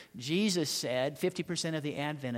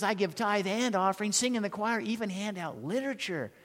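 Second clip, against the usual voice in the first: higher and louder, listing proudly; a kid rattling off his credentials; hammed-up indignant boast; defensive bragging; 6 semitones higher, 3 dB louder than usual. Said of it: "The preacher's kid says".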